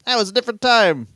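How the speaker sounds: high, incredulous rant; mock disbelief. Reacting to old Lou Reed lyrics, high mock incredulity. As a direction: squeak it up in outraged disbelief.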